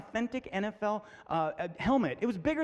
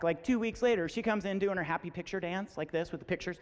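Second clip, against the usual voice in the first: ordinary delivery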